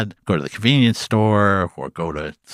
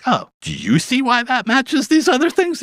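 incredulous question. Retold or imitated. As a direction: stretch the question, puzzled. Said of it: "And I'm like".

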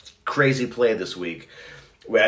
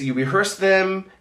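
normal talking voice

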